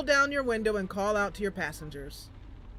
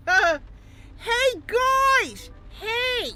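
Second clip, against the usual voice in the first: high, loud tipsy yell; drunk shouting; 13 semitones higher, 5 dB louder, much slower than usual. Acted. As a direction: holler down the alley at your buddies. Ultra Pink calls the group.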